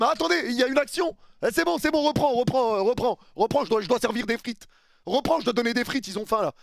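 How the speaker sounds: high, loud, fast flustered snack vendor; hurried snack vendor. Pretend. Fan voices a VAR referee rushing back to the fryer, high flustered hurry. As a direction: play a ref too busy serving fries to watch.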